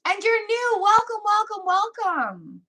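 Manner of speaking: high, cooing greeting; gushing welcome. Real, not acted. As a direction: welcome a newcomer in a high voice, dropping at the end. Welcomes a newcomer.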